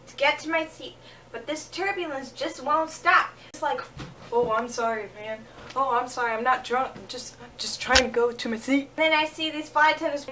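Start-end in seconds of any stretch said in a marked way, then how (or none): 4.3-8.9 put-on lower, mumbled sorry-not-drunk voice; stumbling apology. Lower apologetic mumble while lurching down the aisle. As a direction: play a man staggering and apologizing.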